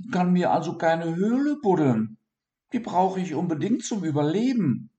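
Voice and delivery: high worried little voice; high pleading dormouse. Acted. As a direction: explain the trouble earnestly. Dormouse explains his problem.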